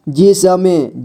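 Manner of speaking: जिसमें drawn out as जि-स-में; syllable by syllable. Shown as a demonstration. Teacher showing beginners how to read जिसमें, syllable by syllable. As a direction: sound out each letter of जिसमें. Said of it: "जोड़ जोड़ करके ही पढ़ना होगा".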